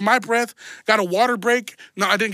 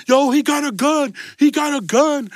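higher-pitched mock panic; retold alarm, pitched up; freaking out; 4 semitones higher than usual. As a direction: imitate a crowd yelling a warning. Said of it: "everybody started freaking out".

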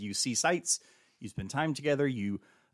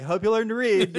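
louder, higher teasing repeat; teasing dad; 10 semitones higher, 9 dB louder than usual. Retold or imitated. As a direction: rub it in with a grin. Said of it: "I'm like".

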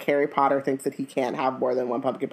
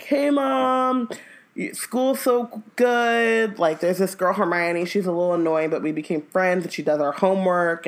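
put-on cheerful boy; 2 semitones higher than usual. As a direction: play the son gushing about school in a letter. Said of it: "Ron's like".

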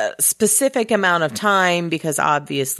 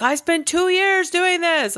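mock proud villain; 11 semitones higher than usual. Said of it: "he's like".

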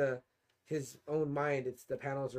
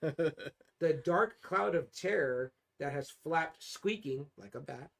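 very slow, weighty rereading; drawn out for dramatic effect; savoured reading; much slower than usual. Acted. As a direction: linger on every phrase.

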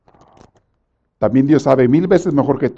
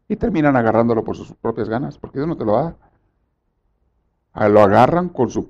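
usual speaking voice

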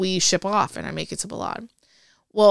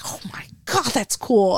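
breathless, higher thrilled gasp; excited gasp; 3 semitones higher than usual. Retold or imitated. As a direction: gush over the news. Retold excitement for friend.

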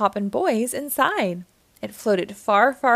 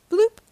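bubble pop sound; 12 semitones higher, 7 dB louder than usual. A narrator's reading. Bubble pops out.

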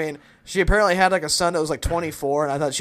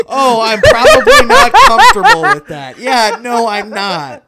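much higher and louder through laughter; laughing shout; 7 semitones higher, 13 dB louder than usual. Retold or imitated. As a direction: burst out laughing as you refuse. Own reply, laughing shout.

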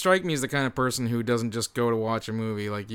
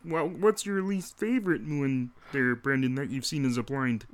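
Kermit the Frog; 2 semitones higher than usual. Pretend. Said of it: "now can you do your Kermit the Frog impression".

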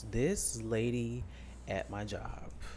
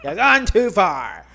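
slow, loud, higher scolding repeat; mock loud warning; 6 semitones higher, 13 dB louder, slower than usual. Host scolding co-host, loud and slow. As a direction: drag out a fake outraged warning.

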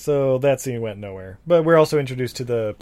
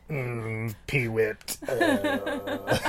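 creepy creature voice; 6 dB quieter, much slower than usual. Retold or imitated. Voicing the gin creature.

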